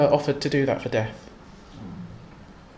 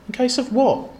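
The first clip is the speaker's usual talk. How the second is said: higher, curious duck; 8 semitones higher than usual. Acted. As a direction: ask it like a small duck.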